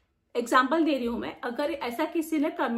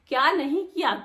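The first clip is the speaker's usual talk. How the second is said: ordinary delivery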